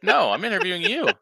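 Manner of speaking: high, loud incredulous protest; indignant. Retold or imitated. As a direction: push back in loud disbelief. Other guy quoted, indignant.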